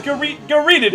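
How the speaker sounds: usual speaking voice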